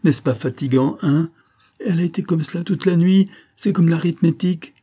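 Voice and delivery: high-pitched, exasperated nurse; fed-up young nurse's loud grumble; exasperated complaint. A narrator's reading. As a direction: appeal for sympathy, pitch climbing. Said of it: "dans son désespoir le major à témoin".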